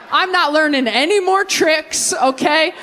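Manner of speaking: usual speaking voice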